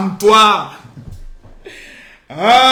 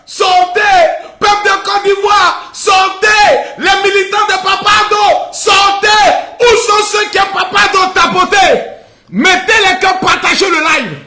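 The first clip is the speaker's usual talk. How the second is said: high, loud streamer hype; rally the viewers at full volume; hype shouting; 6 semitones higher, 4 dB louder than usual. Real, not acted. Streamer urging viewers to share the live, loud hype.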